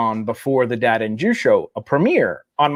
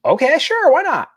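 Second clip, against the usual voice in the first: high carefree; 13 semitones higher than usual. Retold or imitated. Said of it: "retold own reply".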